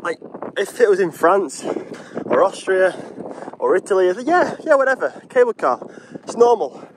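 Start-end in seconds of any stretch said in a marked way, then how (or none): 4.2-6.9 higher dismissive yeah yeah, whatever; mock shrug about cable cars abroad; mock blasé. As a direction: wave it off as nothing special. Mocking blasé foreign attitude.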